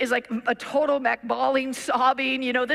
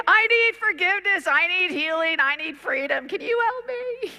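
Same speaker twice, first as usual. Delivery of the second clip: high, raised voice, begging for help; desperate plea; 8 semitones higher, 4 dB louder than usual. Retold or imitated. Distraught girl pleads with the visiting preacher. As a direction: cry out what you need, louder at first. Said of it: "And the girl goes".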